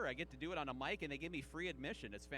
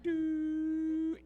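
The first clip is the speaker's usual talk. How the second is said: brain freezing mid-sum; brain stalling; 2 semitones higher, 5 dB louder than usual. Pretend. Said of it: "I'm just like".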